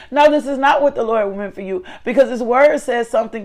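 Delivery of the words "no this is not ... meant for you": normal talking voice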